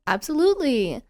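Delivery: Absolutely high and emphatic, falling. Real, not acted. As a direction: stress Absolutely high and firm. Enthusiastic agreement.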